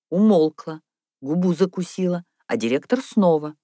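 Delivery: ordinary delivery, nothing marked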